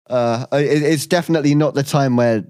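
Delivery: normal talking voice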